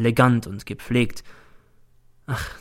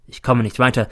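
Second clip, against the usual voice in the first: ordinary delivery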